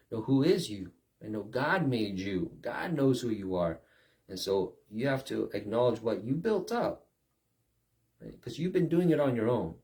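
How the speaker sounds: usual speaking voice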